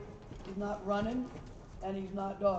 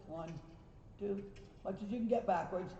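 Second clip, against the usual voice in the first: usual speaking voice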